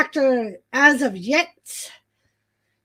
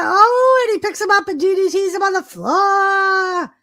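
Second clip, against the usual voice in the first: excited shout; 6 semitones higher than usual. Real, not acted. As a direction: yell the big move out. Big move in the match.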